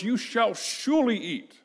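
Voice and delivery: ordinary talk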